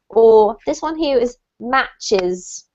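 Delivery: slow, careful "matches" with the final S sounded; clear word endings. Shown as a demonstration. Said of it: "pronounce the ends of the words clearly".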